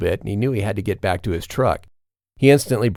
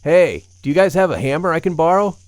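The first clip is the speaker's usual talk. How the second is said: much higher, louder call; calling out again; 9 semitones higher, 6 dB louder than usual. A narrator's reading. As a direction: call across the clearing, pushing harder. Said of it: "he called out again".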